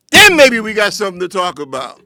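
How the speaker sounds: usual speaking voice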